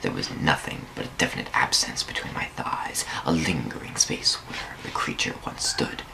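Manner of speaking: hushed, breathy storytelling whisper; whispered narration. Acted. Narrator whispering the dream's fading warmth to listeners. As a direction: whisper it like a secret half-dream.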